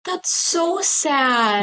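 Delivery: high, drawn-out pitying whine. Real, not acted. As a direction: stretch it out slowly in high-pitched sympathy. Reacting to a tragic story.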